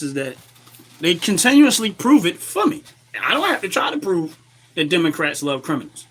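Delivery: ordinary delivery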